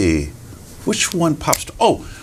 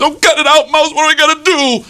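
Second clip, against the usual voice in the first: very high, loud wail; frantic fox; 5 dB louder than usual. Acted. Trapped fox begs mouse for a plan, frantic. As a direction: plead loudly in a high panic.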